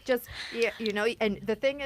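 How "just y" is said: normal talking voice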